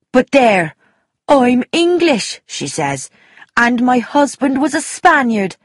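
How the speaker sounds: emphatic Irish voice, lifting on mimicked quotes; Irish countrywoman gossiping. Irishwoman insisting she knows her countrywomen, emphatic. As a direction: insist like a sure-of-herself Irishwoman.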